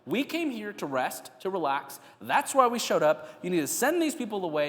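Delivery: high-pitched, indignant complaint; sarcastic disrespect. Acted. Disciples complaining to Jesus about the crowd, sarcastic disrespect. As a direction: push back with mild sarcasm. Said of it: "this is sarcasm, this is disrespect".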